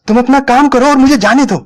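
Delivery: near scream. A narrator's reading. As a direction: let it burst out as a near-shriek. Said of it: "फिर करीब करीब चीख पड़ी". (लगभग चीखती हुई आवाज़)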